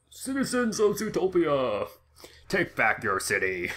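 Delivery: booming villain announcement; Bane voice. Retold or imitated. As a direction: proclaim like a masked villain. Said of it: "And he runs into Zootopia Stadium and says".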